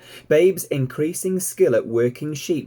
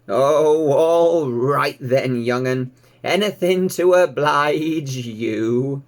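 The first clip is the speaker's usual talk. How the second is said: put-on slow, louder weary old sheep complaining; grumbling old ewe; 3 semitones higher, 5 dB louder, much slower than usual. A narrator's reading. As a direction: groan it out like a reluctant old ewe. Ma gives in.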